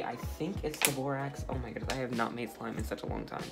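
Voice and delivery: ordinary talk, nothing marked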